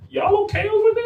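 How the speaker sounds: very high-pitched mock concern; incredulous. Comedian checking on his friend's people, incredulous. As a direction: squeak the question in disbelief.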